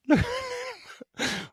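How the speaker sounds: squeaky high giggle. Real, not acted. Laughing at the spider's little mouth with a high giggle. Giggle after cute coo.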